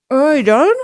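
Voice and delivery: mock post-dental slur, blurting the question; groggy patient. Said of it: "could you hear her? Yeah, but she's like".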